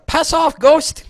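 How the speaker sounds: angry yell. Retold or imitated. Quoting Korg yelling at Loki's illusion, loud mock yell. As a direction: kick the wall and yell it, high. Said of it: "when he gets mad".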